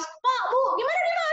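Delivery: mock-loud, high-pitched calling out; high, loud nurse voice. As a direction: call out too loudly to a shy patient. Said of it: "kalian ngomongnya keras-keras".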